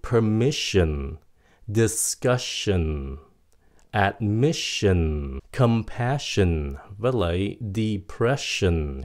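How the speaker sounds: slow word list, "ssion" said "shin"; "shin" endings. Shown as a demonstration. Teacher gives double-S examples, "ssion" as "shin". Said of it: "Toàn là shin".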